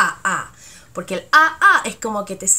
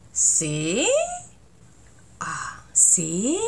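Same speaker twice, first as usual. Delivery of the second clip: disbelieving question; 4 semitones lower, much slower than usual. Shown as a demonstration. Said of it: "la pregunta".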